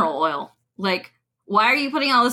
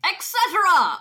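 higher, lightly mimicked announcer; mock announcer echo; 7 semitones higher than usual. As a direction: parrot the old-time ad reader. Echoes the announcer's last words.

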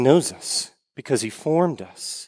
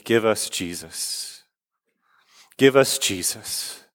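prayer; 4 semitones lower than usual. Real, not acted. Closing prayer.

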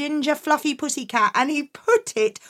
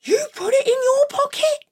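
high gasped disbelief; shocked mum; 13 semitones higher than usual. Acted. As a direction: gasp it out, appalled.

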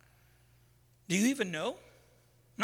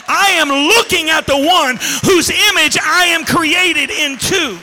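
loud, high, slow preacher's shout; full-force preaching; 6 semitones higher, 9 dB louder, slower than usual. Acted. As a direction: build it to the top of the sermon. Preaching climax.

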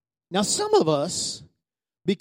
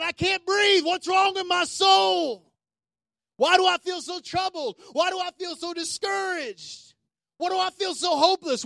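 higher, a touch anguished imitation of a discouraged believer; troubled imitation; 8 semitones higher, 4 dB quieter than usual. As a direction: voice the despairing questions, mildly pained. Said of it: "And you're going".

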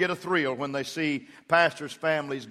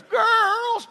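very high falsetto mock yell; drowning-sounding call; 4 dB louder than usual. Wife calling girls upstairs, sounding like she's drowning. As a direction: imitate a wife's failed holler, high and warbling. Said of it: "it sounds like she's drowning".

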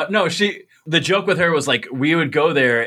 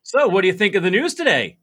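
high Allentown lilt rolling up and over; hilly, sing-song Allentown question; 5 semitones higher than usual. Shown as a demonstration. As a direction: swoop through it like an Allentown grandma, ending up. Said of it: "it always ended up".

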